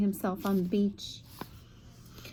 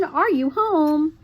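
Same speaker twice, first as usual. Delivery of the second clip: calling out; 5 semitones higher, 4 dB louder, slower than usual. A narrator's reading. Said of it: "called Merton".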